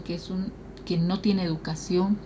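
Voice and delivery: ordinary talk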